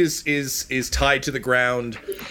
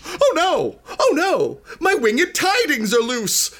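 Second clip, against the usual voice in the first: high-pitched comic wail; panicked, high character voice; distraught Iggy; slower than usual. Acted. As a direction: cry out in hammed-up alarm, stretching the words. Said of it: "and he's like".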